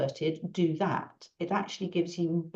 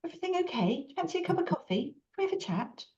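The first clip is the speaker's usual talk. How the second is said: higher, softer, gentle approach to a shy stranger; friendly check-in; 11 semitones higher, 5 dB quieter, faster than usual. Retold or imitated. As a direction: lightly offer coffee, soft and kind. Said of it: "go over and go".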